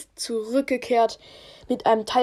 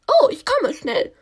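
high, loud queen voice; chirpy royal mother; 7 semitones higher, 6 dB louder than usual. Acted. Queen answers.

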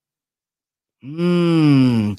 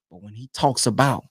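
normal talking voice

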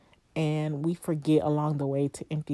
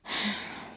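soft, breath-only sigh; audible sigh; 5 semitones higher, 6 dB quieter than usual. Real, not acted. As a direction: let out a quiet sigh. Sighs before continuing her account.

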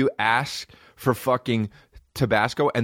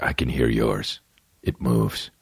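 low, mocking Professor Xavier voice, dropped much lower; 12 semitones lower than usual. Professor Xavier brushes off the worst X-Men, in a low put-on voice. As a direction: growl it out low like a stern mentor dismissing a student. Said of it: "He's like".

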